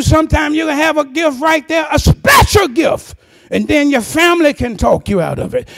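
loud, high, ringing sermon cry; full-force preaching. Acted. Preacher urging the congregation to hold their gift, shouting.

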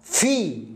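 في with a long "ee"; i vowel. Shown as a demonstration. Teacher showing F followed by I, long ee in في. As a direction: stretch في out as "fee". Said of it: "يصبح الإف هذا مكسور".

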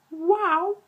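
very high, loud squeal of amazement; amazed wow. Real, not acted. Reviewer marvelling at a brilliant plot, high-pitched amazement. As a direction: squeak it out in awe.

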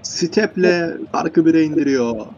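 high, loud, drawn-out announcement; excited goal call. Real, not acted. Commentator interrupting a guest to announce a goal, excited. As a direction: cut in with a slow, ringing shout, easing slightly.

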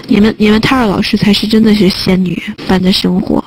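ordinary talk, nothing marked

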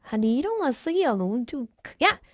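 ordinary talk, nothing marked

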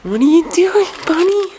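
pet talk. Real, not acted. Talking to the pet bunny.

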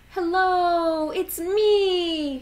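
high, loud call of Stanley. A narrator's reading. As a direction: call out to friends brightly. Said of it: "he called to his friends".

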